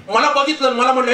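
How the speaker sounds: usual speaking voice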